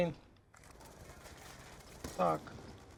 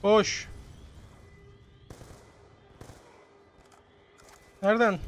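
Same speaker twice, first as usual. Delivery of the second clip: loud, higher panicked calls; shouting; 8 dB louder, slower than usual. Real, not acted. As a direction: yell it out in alarm.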